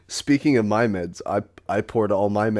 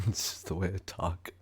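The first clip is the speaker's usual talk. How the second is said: mock echo; 3 semitones lower, 12 dB quieter than usual. Friend mimics the line.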